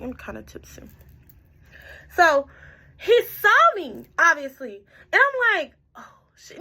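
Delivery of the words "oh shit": hushed whispered curse, very soft; whispered dismay. Retold or imitated. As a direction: whisper it like you've just been caught. Retelling her alarm at being spotted.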